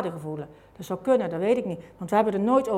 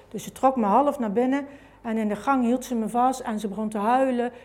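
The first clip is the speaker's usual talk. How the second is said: usual speaking voice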